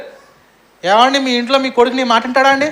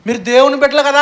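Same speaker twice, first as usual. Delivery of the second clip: "మీరు దేవుని బిడ్డలు కదా" called out louder and higher; louder, higher-pitched retelling of what people say; loud exclaimed quote; 5 semitones higher, 6 dB louder than usual. Retold or imitated. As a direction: proclaim it like the townsfolk shouting it. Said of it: "ఏమంటారు మనల్ని".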